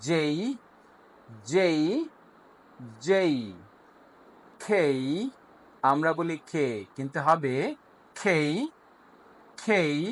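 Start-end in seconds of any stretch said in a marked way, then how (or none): 0.1-3.4 জেই said with a short ee glide at the end. Shown as a demonstration. Teacher drills students on জেই with a short ee ending. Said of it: "কিন্তু শেষে একটা ই sound হবে". 8.2-10.0 খেই said with a short ee glide at the end. Shown as a demonstration. Teacher corrects খে to খেই for learners. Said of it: "শেষে একটা short ই sound হবে".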